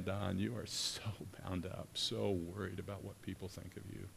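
soft imagined voice of Jesus; gentle, compassionate. Acted. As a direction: speak softly, as if confiding. Voicing Jesus speaking gently to him.

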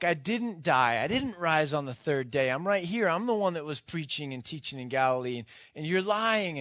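insistent protest. Retold or imitated. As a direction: insist hard that he's right here. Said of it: "And Jesus is like".

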